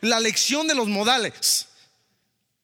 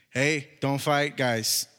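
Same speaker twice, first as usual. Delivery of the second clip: mild mock child's plea, slow and lower; son's plea; 7 semitones lower, much slower than usual. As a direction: gently voice the boy asking his parents, slowly. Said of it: "luego me dice Jesse". (imitando suavemente al hijo que pide, lento y más grave)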